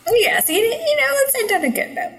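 mock cheerful.

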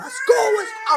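high, loud caveman yell of joy; Neanderthal screaming. Retold or imitated. Fan at the phone store screaming at the TV, loud and high. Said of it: "I'm screaming ... like a Neanderthal".

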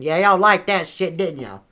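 ordinary talk, nothing marked

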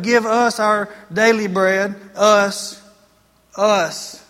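"us" slowed and leaned on, then repeated alone; preacher pointing out the plural "us"; stress on us. Shown as a demonstration. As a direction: hammer the word "us" to show it's plural. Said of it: "Now notice me back up".

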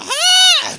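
sharp high scream of fright; mock scream, very high and loud; panicked yelp. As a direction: yelp like the wave hits you. Mimics panic under the wave.

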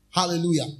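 normal talking voice

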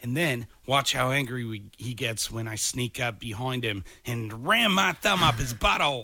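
put-on lightly done nature-host Australian accent; a hint of Australian wildlife host commentary; Steve Irwin Australian accent. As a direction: narrate like an excited Australian wildlife presenter. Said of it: "a stereotypical Steve Irwin cranked up to eleven kind of Australian accent".

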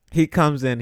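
ordinary talk, nothing marked

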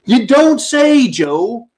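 loud, slow, sneering you don't say; sarcastic shout. Acted. Host mocking the president's clip, loud and sarcastic. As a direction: bellow the sarcasm, drawn out.

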